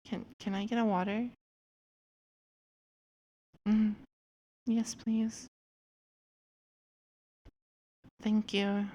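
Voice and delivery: tiny, hushed and hesitant; shy character voice. Acted. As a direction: speak up only barely, shy and small. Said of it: "That's how she talks".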